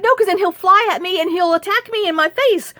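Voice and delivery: high, rushing panicky retort; retold alarm, pitched high; panicked protest. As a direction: protest in a rising, worked-up voice.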